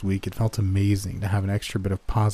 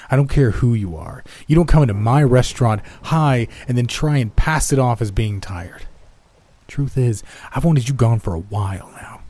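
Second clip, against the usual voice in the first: angry boss; 3 semitones higher, 5 dB louder than usual. Acted. Boss firing him.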